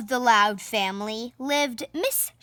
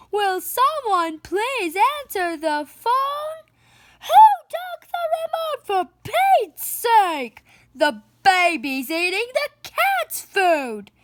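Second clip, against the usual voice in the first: much higher, louder chaotic yelling; loud family yelling; 9 semitones higher, 5 dB louder than usual. Acted. The Louds shouting at each other at home, high loud yelling. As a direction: yell over all the noise at home.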